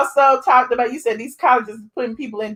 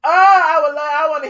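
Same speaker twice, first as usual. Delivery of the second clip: excited high outburst; 5 semitones higher, 3 dB louder than usual. Real, not acted.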